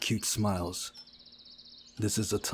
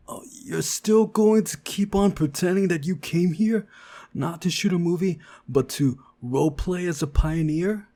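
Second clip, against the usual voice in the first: much higher, louder incredulous outburst; exasperated; 9 semitones higher, 7 dB louder than usual. Acted. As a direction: blow up at their game. Exasperated at friends' pretence.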